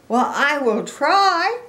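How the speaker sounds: high, loud, determined pig; Piggy. Acted. Piggy answers Gerald's doubts, high and determined. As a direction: rise eagerly on a stretched "try".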